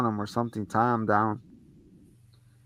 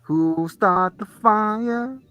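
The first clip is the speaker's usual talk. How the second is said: put-on creepy kid voice; 11 semitones higher, much slower than usual.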